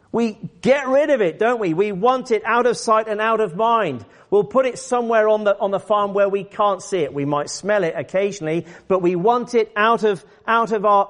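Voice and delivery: high, emphatic preacher's urging; impassioned preaching. Real, not acted. Preacher builds to urgent exhortation.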